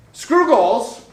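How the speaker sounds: loud, high punchy statement; bold declaration. Real, not acted. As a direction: slam it down like a manifesto. Punchline to the audience.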